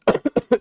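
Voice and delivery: dry sick cough; short cough mid-sentence. Real, not acted. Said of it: "for me being a little under the weather here".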